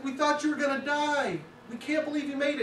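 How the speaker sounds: high cartoon frogs in disbelief; astonished frogs. Frogs crowding round the frog that escaped, high astonished voices. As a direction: chirp it out in disbelief. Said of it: "It's like".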